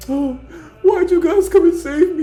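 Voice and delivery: high, loud mocking whine; mock the ungrateful rescued guy. Mocking an ungrateful rescued pirate in a high whine. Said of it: "he was still like".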